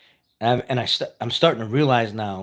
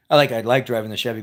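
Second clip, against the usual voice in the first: plain reading voice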